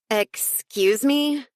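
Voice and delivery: slow, high, indignant excuse me; offended. Acted. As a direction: drag it out, offended. Jenna offended at intruder.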